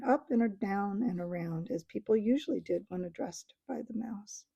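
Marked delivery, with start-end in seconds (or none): none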